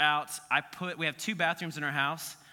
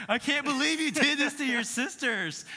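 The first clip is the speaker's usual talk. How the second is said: shocked mom; 10 semitones higher, 4 dB louder than usual. Retold or imitated. Mom scolding, laughing.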